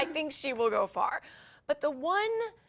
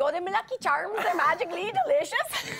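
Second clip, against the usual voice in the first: higher, put-on Irish lilt for a cereal slogan; cheery Irish ad voice, a little off; mock Irish accent; 6 semitones higher, 3 dB louder than usual. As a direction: ham up a Lucky Charms Irish accent. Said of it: "If anyone can do better than that".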